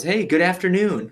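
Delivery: upbeat impression of a chipper cop; chipper greeting. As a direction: chirp a friendly hello, retold.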